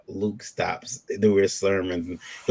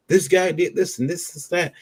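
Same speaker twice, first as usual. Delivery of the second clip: higher, softer put-on gossip; high hushed mock badmouthing; 7 semitones higher, 4 dB quieter than usual. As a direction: spill it like a petty rumormonger. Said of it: "the guy who was spewing the most".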